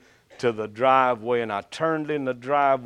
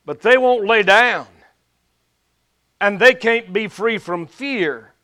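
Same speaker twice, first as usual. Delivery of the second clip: rising, emphatic preacher's build; preaching; 6 semitones higher, 5 dB louder than usual. Acted. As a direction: preach with mounting force. Preaching on the sheep.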